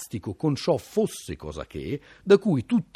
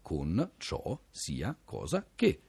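slow, separated, quieter enunciation; word by word; 5 semitones lower, 7 dB quieter, much slower than usual. Shown as a demonstration. Presenting an archaic conjunction to listeners, slow and word by word. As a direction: set each word apart, slowly.

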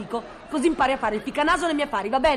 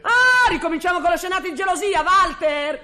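raised, indignant tirade; wife shouting down her husband's supposed jealousy, indignant; angry outburst; 5 semitones higher than usual. Acted. Wife escalating jealous-quarrel accusations.

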